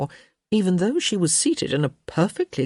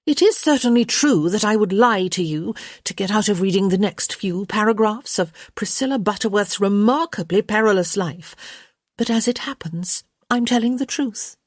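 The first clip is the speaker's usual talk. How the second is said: higher-pitched, poised character voice; wry young Hyacinth; 5 semitones higher than usual. Acted. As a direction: answer back with composed insistence. Voices Hyacinth answering back.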